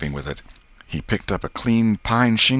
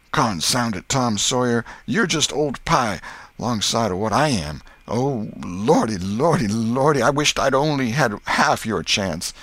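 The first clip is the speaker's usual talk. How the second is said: tearful protest; 6 semitones higher, 4 dB louder than usual. A narrator's reading. Huck envies Tom's chance of salvation, wailing lament. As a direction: wail in earnest self-pity. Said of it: "Huckleberry began to snuffle too".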